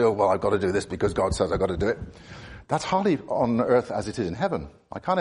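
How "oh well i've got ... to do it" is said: mock grudging mutter, fast; sullen grumbling. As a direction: imitate a resentful believer grumbling quickly. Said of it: "you sullenly and resentfully obey God and say".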